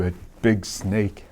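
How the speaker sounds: ordinary delivery, nothing marked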